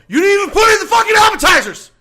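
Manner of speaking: high, loud, all-out yell; furious shout. Retold or imitated. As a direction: blow up at her at full volume. Yelling at the server.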